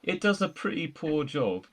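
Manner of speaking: ordinary talk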